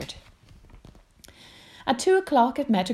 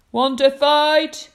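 loud dare; 9 semitones higher, 11 dB louder, slower than usual. Acted. Ladybird dares rhino.

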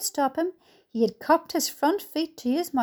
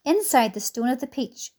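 usual speaking voice

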